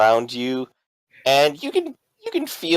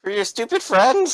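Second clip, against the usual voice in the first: high-pitched, demanding store owner; accusing shop owner; 13 semitones higher than usual. Acted. Store owner demands payment for the booze, high-pitched accusing. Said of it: "As he's accusing you".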